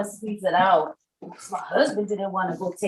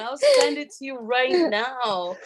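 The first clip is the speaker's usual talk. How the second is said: high, laughing imitation of the husband calming her; husband quoted laughing; 6 semitones higher, 5 dB louder than usual. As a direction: play the husband while laughing. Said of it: "He's like".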